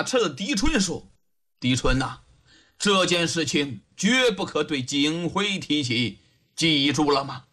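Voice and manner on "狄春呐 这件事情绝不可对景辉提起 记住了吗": low, grave master to servant; deep, measured magistrate; cautioning old official. A narrator's reading. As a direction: give a confidential warning in a low voice.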